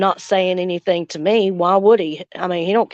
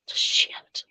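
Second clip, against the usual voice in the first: real breathy whisper, much softer; whispered curse; 10 dB quieter than usual. Real, not acted. Upset about her father, she whispers a curse. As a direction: swear under her breath.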